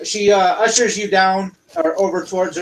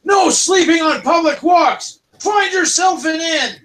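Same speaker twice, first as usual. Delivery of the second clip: high, loud guard bark; shouting high-pitched kobold guards; 9 semitones higher, 3 dB louder than usual. Acted. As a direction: yell at the sleepers like a stern city guard. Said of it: "two kobolds ... go".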